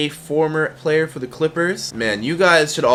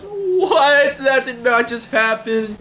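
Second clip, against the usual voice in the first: mock disbelief; 11 semitones higher, much slower than usual. Class stunned.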